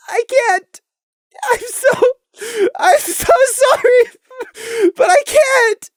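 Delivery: high, sobbing and slow; crying high refusal. Acted. Prey refusing Crimson's plea for a hug, sobbing high. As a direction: sob out the apology.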